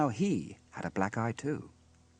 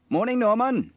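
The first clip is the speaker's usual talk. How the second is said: high, loud greeting; hearty hail; 13 semitones higher, 8 dB louder than usual. A narrator's reading. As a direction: call a friendly good morning. Sam hails the boy outside.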